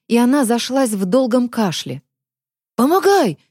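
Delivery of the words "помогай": straining shout for help. A narrator's reading. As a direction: call out hard. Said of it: "крикнул Захар".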